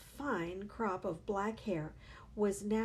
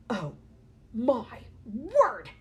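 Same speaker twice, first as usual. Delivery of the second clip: louder dismayed yell; 4 semitones higher, 9 dB louder, much slower than usual. A narrator's reading. Father yelling at his face in the mirror, louder. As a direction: cry out in dismay. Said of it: "yelled the father".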